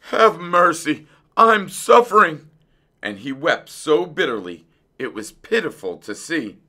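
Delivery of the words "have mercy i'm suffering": high, loud, pleading wail. A narrator's reading. Suffering fox pleading at the dentist's door, loud wail. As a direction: beg for mercy in pain. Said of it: "the fox wailed".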